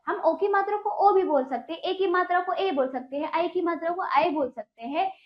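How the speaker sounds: ordinary delivery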